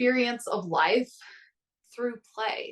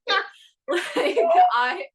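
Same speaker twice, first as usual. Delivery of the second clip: laughing; 11 semitones higher, 7 dB louder than usual. Real, not acted. Laughs about clean house.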